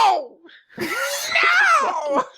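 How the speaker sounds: high screamed mock refusal; hammed-up tantrum shriek; infuriated. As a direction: scream over the clerk's polite question. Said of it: "are infuriated to a certain extent".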